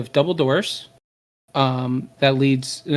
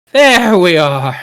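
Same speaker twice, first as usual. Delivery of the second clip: loud, drawn-out, higher welcome; grand greeting; 4 semitones higher, 8 dB louder, slower than usual. Acted. Nos makes her entrance through bursting doors, grand greeting. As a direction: call it out slowly, arms wide open.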